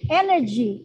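Energy said clearly for repeating; modelled word. Shown as a demonstration. Teacher models the word for children, clear and careful. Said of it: "Oke, kata pertama".

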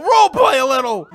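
insist, almost shouting it. Real, not acted. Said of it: "don't play seriously, man".

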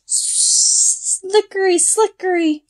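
high, slippery slickery; slithery snake. Acted. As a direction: slide the words like a snake. Snake sounds.

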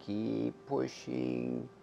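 slow, stretched, soft coaching call; drawn-out coaching. Real, not acted. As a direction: draw out "keep pushing" gently. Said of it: "I'll still coach you to keep blowing".

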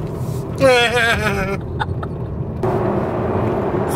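shaky nervous laughing. Real, not acted. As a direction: laugh out the tension. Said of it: "It's nervous laughter".

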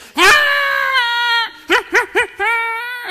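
long, high pig squeal breaking into short squeals; imitated pig squeal. Storyteller mimics the stabbed pig, loud high squealing. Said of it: "That pig just".

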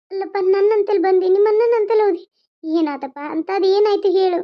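loud, high-pitched worried questioning; urgent motherly alarm. Acted. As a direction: press a crying son for answers. Alarmed mother questions crying son.

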